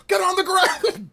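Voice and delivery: high slow mock bark; cop command. Cop yelling at streamer, high mock order. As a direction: shout it down like a cop.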